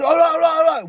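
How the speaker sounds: high, loud, hurried giving-in; imitated exasperated doctor; placating doctor. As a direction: cut in to calm an angry patient.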